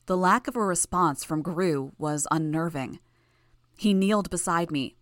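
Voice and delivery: usual speaking voice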